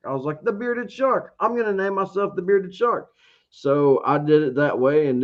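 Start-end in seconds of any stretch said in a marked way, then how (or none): 0.4-3.1 much higher, eureka-style retelling of the new name; excited idea. Retold or imitated. Recalling the moment he named his channel, excited. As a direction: pitch it up like a sudden bright idea. Retelling naming himself after Shark Tank.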